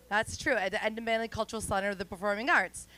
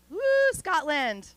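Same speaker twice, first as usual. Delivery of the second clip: mock cheer; 8 semitones higher, 5 dB louder, slower than usual. Cheering her husband's country.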